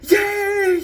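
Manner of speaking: very high, loud, drawn-out squeal of joy; child's cheer. Retold or imitated. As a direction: imitate a little kid cheering. Son cheers about ice cream.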